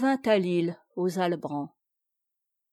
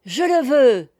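loud, high, eager acceptance; joyful reply; 5 semitones higher, 8 dB louder than usual. A narrator's reading. Noël accepts knighthood of the sea, joyful. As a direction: answer joyfully and at once. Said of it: "répondit Noël avec joie".

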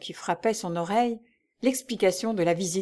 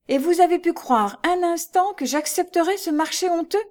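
much higher, loud outrage; outraged disbelief; 10 semitones higher, 4 dB louder than usual. A narrator's reading. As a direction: hurl the question in outrage. Lucie outraged at the offer. (Lucie outrée, voix très haute)

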